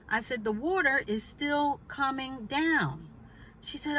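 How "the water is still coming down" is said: "The water is still coming down" slow, high, word by word; more emphasis. Retold or imitated. Telling the neighbor it's still dripping, slow and emphatic. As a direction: spell it out slowly and firmly. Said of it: "with a little bit more emphasis".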